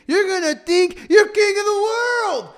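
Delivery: high, impassioned pulpit cry; preaching at full force. Real, not acted. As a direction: drive the point home at the top of your range.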